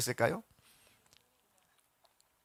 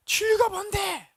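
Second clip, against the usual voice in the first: slow, much higher sneering question; scoffing Pilate; 5 dB louder than usual. Acted. Pilate scoffing at Jesus, slow high sneer. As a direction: toss the question back with contempt.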